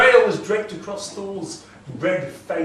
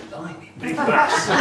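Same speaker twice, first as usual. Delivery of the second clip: amused thought; 2 semitones higher, 5 dB louder than usual. Acted. Thrym thinks, amused.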